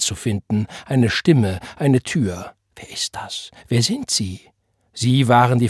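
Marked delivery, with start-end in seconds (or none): 2.7-3.5 breathless frightened whisper; hushed, fearful whisper. A narrator's reading. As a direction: barely voice the question in the dark. Frightened question in the dark.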